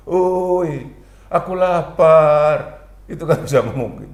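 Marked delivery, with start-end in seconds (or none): 0.1-2.9 pleading hungry soul. Pretend. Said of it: "Kalau rohani itu bisa ngomong".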